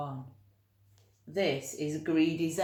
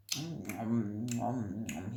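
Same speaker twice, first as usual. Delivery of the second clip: munching hum; 7 semitones lower, 4 dB quieter, slower than usual. A narrator's reading. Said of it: "he went".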